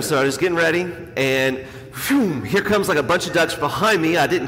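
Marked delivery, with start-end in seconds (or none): 1.9-2.4 spoken whoosh of birds rushing past; imitated whoosh. Duck hunter telling how the flock flew by, imitated whoosh. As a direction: make a quick swooshing noise.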